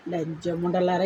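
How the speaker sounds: low, slow, spat-out curse; low contemptuous curse. Real, not acted. Vlogger curses her online critics, low and slow. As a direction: drag the insult out low and slow. Cursing commenters in rant.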